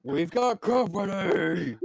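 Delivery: high, drawn-out orc alarm call; acted battle yell, stretched out. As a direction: yell a slow warning to the gang. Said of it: "you hear this one yell".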